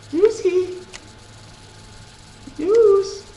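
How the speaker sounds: high-pitched call across the room for the dog. Real, not acted. Calling the dog over.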